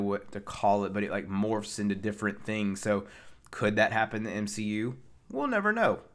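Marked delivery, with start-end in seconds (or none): 5.3-6.0 pitched way up, mock-mysterious; high-pitched feigned uncertainty; coy singsong. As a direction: tease it in a high, playful voice. Teasing open question about future films.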